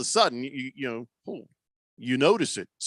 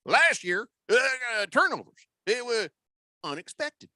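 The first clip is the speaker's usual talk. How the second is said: much higher folksy owner preaching; Jerry Jones impression; 9 semitones higher than usual. Jerry Jones warning Dak about turnovers, much higher folksy voice. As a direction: hammer home the ball-baby advice as the owner.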